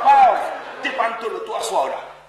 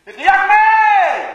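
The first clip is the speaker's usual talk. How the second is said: long slow yell, much higher and louder; drawn-out shout; 8 semitones higher, 11 dB louder, much slower than usual. Acted. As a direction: cry out slowly for help. Shouting in the night. (cri long et fort)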